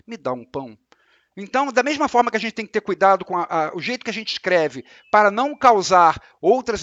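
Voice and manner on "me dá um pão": softer, lower polite ask; gentle request. Shown as a demonstration. Teacher showing the polite way to read it. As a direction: ask kindly, not demanding. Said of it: "ela pode estar pedindo".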